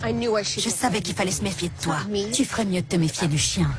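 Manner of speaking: a cold threat in a strange, deep voice; sinister low voice. Acted. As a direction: speak like something else is talking through you. Sinister turn during the escape.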